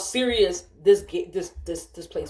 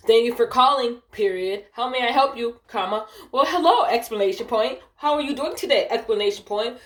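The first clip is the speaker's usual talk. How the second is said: high, exaggerated sing-song script reading; mock-chirpy greeting, pronouncing every punctuation mark; smart-ass recitation; 5 semitones higher, 4 dB louder than usual. Agent reading script word for word to supervisor, smart-ass. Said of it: "You're being a smart ass".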